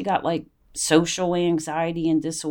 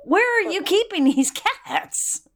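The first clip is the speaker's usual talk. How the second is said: incredulous scolding; 7 semitones higher than usual. Retold or imitated. Scolding daughter over wasted college.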